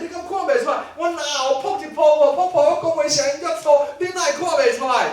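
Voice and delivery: 高声嚷嚷地抗议; 像被误会的人大声辩解; 夸张的不服气. Acted. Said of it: "第二个讲". (拉高嗓门、不服气地辩解)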